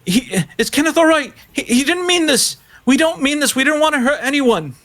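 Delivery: very high falsetto, pleading; distraught woman. Acted. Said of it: "She's just like".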